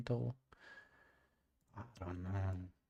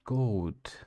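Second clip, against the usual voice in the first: ordinary talk, nothing marked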